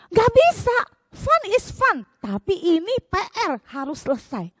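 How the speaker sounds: high, loud, firm refusal; strict teacher. Retold or imitated. Teacher refusing the pupil's plea, high and firm. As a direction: shut down the excuse sternly. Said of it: "Miss Lucy galak".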